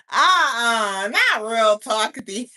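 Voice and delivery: shouting through laughter; loud laugh at the silent guest. Real, not acted.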